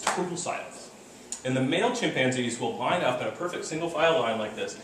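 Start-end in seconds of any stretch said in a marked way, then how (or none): 0.0-0.9 hushed. Shown as a demonstration. Describing chimps going silent.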